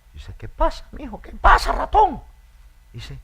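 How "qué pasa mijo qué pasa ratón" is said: high hen's voice; a high-voiced hen asking what's wrong. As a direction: answer like a fussy old hen. Hen answers the mouse.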